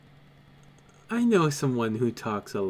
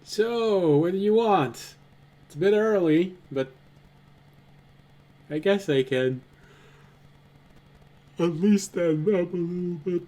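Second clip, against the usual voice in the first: higher, louder NPC voice; character voice; 6 semitones higher, 3 dB louder than usual. Acted. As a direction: speak up as the character. GM voicing a character.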